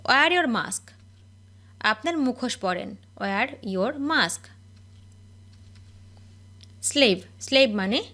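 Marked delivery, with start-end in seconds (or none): 3.2-4.4 very slow, word by word; each word drawn out for learners to copy; slow dictation. Shown as a demonstration. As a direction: spell it out slowly for the class. Repeated slowly for learners.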